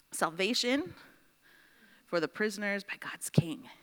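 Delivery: the second half drops to a whisper; soft, whispered restatement; hushed repeat. Shown as a demonstration. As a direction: let it fall into a whisper so it sinks in. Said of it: "repeats the same emphasis, hushed".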